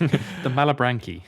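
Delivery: slow "Malebranche" ending in "-kee"; alternative pronunciation. Shown as a demonstration. Offering another reading of the devil name, slow "Mala-brank-ee".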